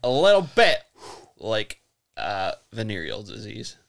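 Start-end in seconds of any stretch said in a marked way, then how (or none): 0.0-0.8 higher and louder, emphatic; louder repeat. Real, not acted. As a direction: push it over the interruption. Repeats after being interrupted.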